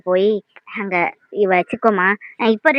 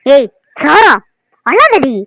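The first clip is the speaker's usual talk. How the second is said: sharp scolding shout; 3 semitones higher, 7 dB louder, much slower than usual. Acted. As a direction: snap at the child to stop crying. Scolding crying baby at bath.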